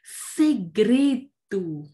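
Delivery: Segredo split slowly into se-gre-do; teacher sounds out Segredo syllable by syllable; syllables pulled apart. Shown as a demonstration. Teacher showing pupils how to split words, slow syllables.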